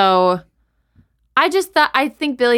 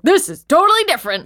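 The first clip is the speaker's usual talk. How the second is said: excited quote; 9 semitones higher, 3 dB louder, faster than usual. Retold or imitated. Acting out own reaction.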